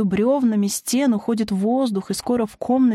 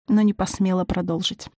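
ordinary delivery

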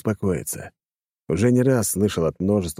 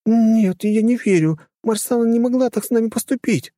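high, raised female voice in denial; disbelieving protest; 4 dB louder than usual. A narrator's reading. Captive girl refusing to believe the betrayal, disbelieving. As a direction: protest in a much higher pitch.

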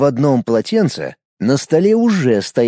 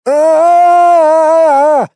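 long high scream of shock; startled scream; 13 semitones higher, 7 dB louder than usual. A narrator's reading. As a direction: scream as icy water hits. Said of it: "закричал застигнутый врасплох Андрей".

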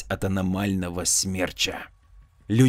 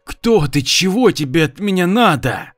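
hissed through teeth; 7 semitones higher, 4 dB louder than usual. A narrator's reading. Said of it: "прошипел сквозь зубы Амур".

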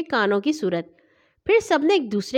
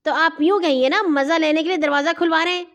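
higher, piqued retort; irritated; 6 semitones higher, 3 dB louder than usual. A narrator's reading. Boy retorts to teasing robber, irritated. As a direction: snap back with mild annoyance. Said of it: "میں نے جل کر کہا".

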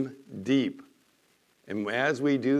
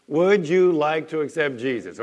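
louder group repeat; 4 semitones higher, 9 dB louder than usual. Shown as a demonstration. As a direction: call it out louder with the congregation. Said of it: "Say it with me".

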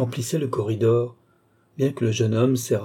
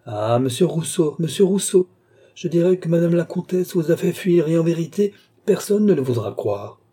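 high, raised teasing call; gleeful shouting; 6 semitones higher, 4 dB louder than usual. A narrator's reading. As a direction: shout after him merrily, easing off at the end. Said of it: "crier et rire de toute sa force". (cris joyeux et moqueurs)

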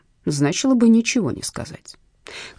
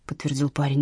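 plain reading voice

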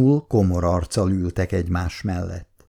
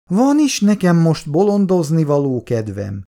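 annoyed; 7 semitones higher than usual. A narrator's reading. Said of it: "mérgelődött Szabóné".

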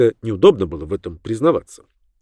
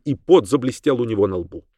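usual speaking voice

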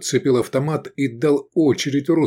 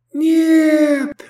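very high, loud scream; long, piercing wail; 7 dB louder than usual. A narrator's reading. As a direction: scream it out in desperation. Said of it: "завопил, выскакивая Турап".

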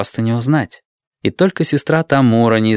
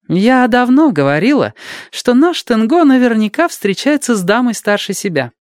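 boastful nurse; 13 semitones higher than usual. Acted. Tamura boasting to friends.

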